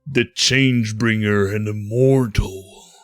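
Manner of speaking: slow, loud, ominous intoning; foreboding voice. Acted. Dark voice breaks into the divine scene, foreboding. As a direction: intone it with looming menace. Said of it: "A foreboding voice cut through this scene".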